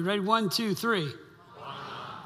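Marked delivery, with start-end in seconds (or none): 1.6-2.2 call and response. Shown as a demonstration. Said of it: "so try that with me".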